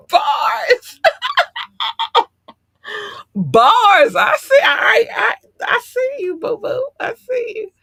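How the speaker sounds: high squeals through helpless laughter; cracking up. Real, not acted. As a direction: shout it while dying laughing. Cracks up at the joke.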